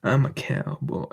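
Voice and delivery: slow Southern cowboy drawl; put-on slow Southern US accent. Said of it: "with a heavy Southern accent".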